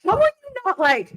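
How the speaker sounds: high, puzzled question; wondering what is going on. Retold or imitated. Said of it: "I'm like".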